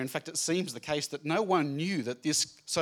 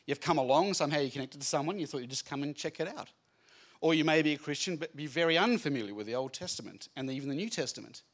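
ordinary delivery

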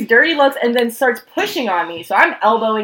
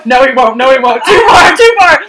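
laughing shout; 2 semitones higher, 10 dB louder than usual. Retold or imitated. Holding friend back, yelling at him to stop. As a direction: yell "too far" through laughter.

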